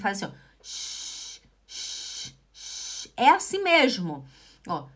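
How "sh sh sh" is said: three soft hushing shushes; shushing. Shown as a demonstration. Teacher pretending to ask for silence, soft shushes. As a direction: shush someone repeatedly. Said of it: "pedindo pra alguém fazer silêncio".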